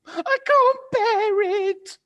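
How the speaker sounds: put-on high, loud old-woman cry; crying out in alarm. Missus Twit frightened, crying out to her husband. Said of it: "cried Missus Twit".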